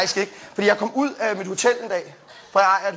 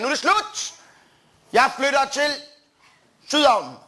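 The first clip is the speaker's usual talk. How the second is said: triumphant declaration; 5 semitones higher, slower than usual. Retold or imitated. Young comic announcing his move to Sydhavnen, high mock-grand cry. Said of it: "så sagde jeg".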